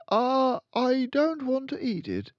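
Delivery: put-on high, loud, pleading child voice; a scared boy refusing; boy refusing the headmistress's cake; reluctant boy. A narrator's reading.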